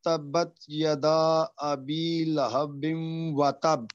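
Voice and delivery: slow, drawn-out recitation of the verse; slow Quran recitation. Shown as a demonstration. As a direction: recite it carefully, letter by letter. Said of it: "اب یہ پوری آیت پڑھیں".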